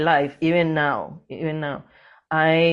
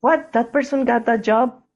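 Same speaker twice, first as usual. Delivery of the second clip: higher, disbelieving question; incredulous; 7 semitones higher than usual. Retold or imitated. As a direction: act shocked at their luck. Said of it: "you're like".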